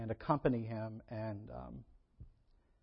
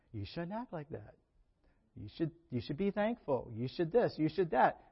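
scolding voice; 2 semitones higher than usual. Retold or imitated. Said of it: "what do you think God would say".

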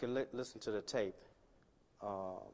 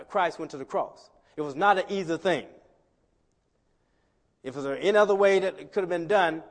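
loud, high preaching; preaching at full force; 4 semitones higher, 8 dB louder than usual. Acted. Preacher stirring the congregation about the cross. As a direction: drive it home to the church.

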